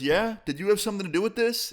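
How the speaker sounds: high-pitched, quick imitation of a suspicious dad; mock parental interrogation; accusing question.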